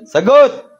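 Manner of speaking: high, loud shout for an answer; sharp demand. Real, not acted. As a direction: shout it as an order, pitch jumping up. Demands an answer during an interrogation.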